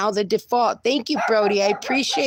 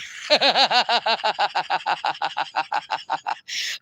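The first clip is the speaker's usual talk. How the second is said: delighted laugh; 2 semitones lower than usual. Real, not acted. Raided by a big group.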